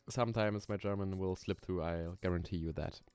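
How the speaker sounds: ordinary talk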